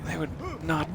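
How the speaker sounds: high, frantic apology to police; panicked bystander. Pretend. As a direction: play a panicked witness.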